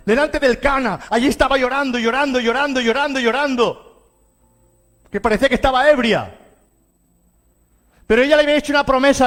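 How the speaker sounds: high, forceful pulpit voice; fervent preaching. Real, not acted.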